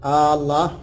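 آه الله with the opening hamza stretched long like a question; drawn-out hamza. Shown as a demonstration. Teacher shows students the wrong long hamza. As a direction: stretch the first vowel of آه الله too long. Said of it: "لا تمد الهمزة".

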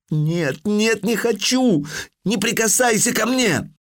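put-on high, pleading cry of a scared girl; terrified plea. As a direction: beg him not to touch her. Девочка кричит в кошмаре. (испуганная мольба девочки)

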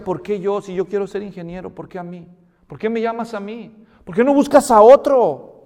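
rising to an anguished shout; plead, then cry out why me; retold angry prayer to God; angry protest. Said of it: "Estaba enojado y estaba triste".

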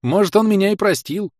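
high, loud hopeful cry; joyful shout. A narrator's reading. Hero joyfully shouting to his friend coming down, happy cry. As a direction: call it out gladly. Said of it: "радостно крикнул я".

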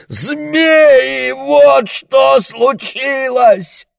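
high, loud and very slow, spitting it out; outraged brother explaining to mother; furious shouting. Acted. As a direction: hammer out each word in rage.

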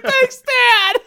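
mock child voice, high and half-crying; small child. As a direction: play a beaming small child thanking dad. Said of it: "proud father with a small child".

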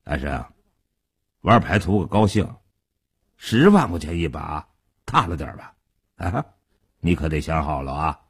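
deep-pitched, easygoing warning; unhurried older gangster. A narrator's reading. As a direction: caution the youngster without any hurry. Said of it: "傻彪还是一副慢吞吞的模样".

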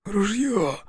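wheezing, gurgling through blood; choking on blood. A narrator's reading. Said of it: "хрипел, захлёбываясь кровью".

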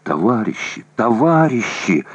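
squeezed out, hoarse and choking; choked hoarse. A narrator's reading. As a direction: force it through a tight throat. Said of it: "удушливо хрипло и жутко выдавил горлом".

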